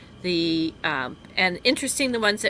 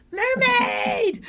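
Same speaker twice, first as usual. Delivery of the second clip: very high mock holler; playful yell. Joking to Amy about yelling at the water, playful high yell. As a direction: holler it high for fun, on the edge of laughing. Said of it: "going to the water and yelling".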